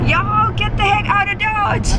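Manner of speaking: Southern twang, put on for fun; Southern accent. A little girl asked for her Southern accent, playful Southern twang. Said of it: "a southern accent when she wants to".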